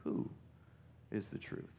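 "Who" set alone, long pause, then a hushed rest; soft, deliberate "Who", a beat before finishing; stress on WHO. Retold or imitated. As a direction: isolate "Who" and let the rest fall very soft. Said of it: "he should have asked the question".

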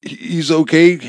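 high, loud stammered worry; stumbling, anxious repetition; sputtering. A narrator's reading. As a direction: sputter it out in alarm. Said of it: "Amy sputtered".

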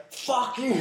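mock yell out window. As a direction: fling it at the cyclist. Dad yelling out car window.

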